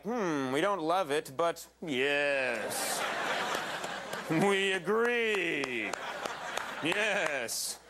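drawn-out, measured mock approval; grudging approving audience. Host voicing the audience's confusing reaction, drawn-out grudging approval. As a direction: stretch it out like a deliberating body giving consent. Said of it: "Kind of like".

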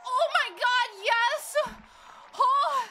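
real relieved triumph; excited yell. Real, not acted. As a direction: shout with relief at passing. Right answer, celebrates.

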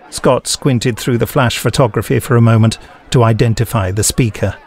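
usual speaking voice

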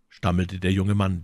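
ordinary delivery, nothing marked

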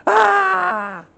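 a high, loud mock shriek of panic; hammed-up cry of alarm; panicked scream. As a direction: shriek like the terrified servant. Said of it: "this guy's freaking out".

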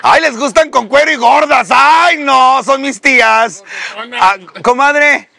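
high, chatty comadre voice; gossipy aunt. Pretend. Host mocking the audience's tastes, as a gossipy comadre.

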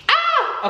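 very high shrill squeal; a piercing happy scream; excited squeal. Real, not acted. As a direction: let the excitement burst out.

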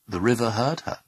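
usual speaking voice

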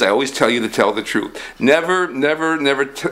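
normal talking voice

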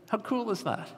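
high, soft delighted aside; excited. Real, not acted. Preacher delighting to the congregation, high and hushed. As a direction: marvel at it quietly. Preacher marvels at God working alongside.

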